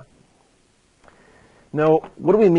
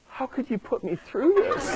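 put-on much higher, softer voice of a wounded toddler, with a laugh; hurt little boy; 12 semitones higher, 5 dB quieter than usual. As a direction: mimic a betrayed three-year-old, half laughing. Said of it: "he just looked at me like".

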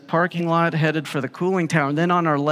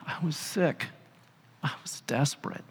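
softly confessing the whole truth; hushed admission of desperation; woman confessing to Jesus at his feet; quiet confession; 10 dB quieter than usual. Acted. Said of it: "she just tells him the whole truth".

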